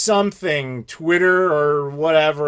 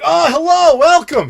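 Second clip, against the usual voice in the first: much higher, louder, beaming welcome; super excited; 11 semitones higher, 7 dB louder than usual. Retold or imitated. Friendly hotel man greeting weary guests, overjoyed higher welcome. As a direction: greet them like a thrilled host. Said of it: "He was super nice, super excited".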